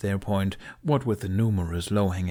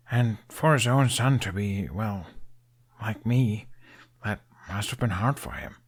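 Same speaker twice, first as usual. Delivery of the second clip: a hint of young Hiccup owning his failings; Hiccup, rueful. Hiccup admits to Toothless he disappointed his father, rueful.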